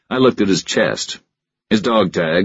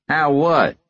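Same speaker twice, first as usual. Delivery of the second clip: Stockton voice; 10 semitones higher than usual. A narrator's reading. Stockton replies.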